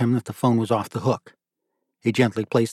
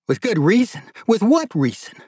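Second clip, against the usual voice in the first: much higher, rising "With good reason?"; incredulous; 10 semitones higher than usual. Acted. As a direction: echo it back in outraged disbelief. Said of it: "his tone becoming more incredulous".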